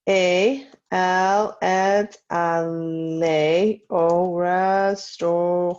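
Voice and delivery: very drawn-out French syllables; slow dictation. Shown as a demonstration. Teacher to class copying sentences, very slow dictation. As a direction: stretch each word slowly for people writing it down.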